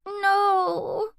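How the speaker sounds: put-on high, tearful little girl no; a toddler sobbing out a refusal; sobbing child. A narrator's reading. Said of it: "she sobs".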